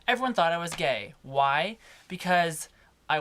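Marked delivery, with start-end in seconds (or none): none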